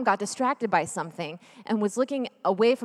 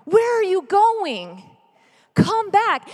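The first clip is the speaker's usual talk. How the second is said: high, loud calls, slower on the question; frantic calling out; 9 semitones higher, 5 dB louder, much slower than usual. Acted. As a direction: shout after a lost child. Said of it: "there is a frantic search for you".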